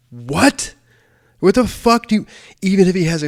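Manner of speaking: high, loud, incredulous outburst; freaked-out disbelief. Retold or imitated. Told his cop may carry a gun, shrieking in disbelief. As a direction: shriek it in disbelief, almost yelling. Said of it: "I'm like".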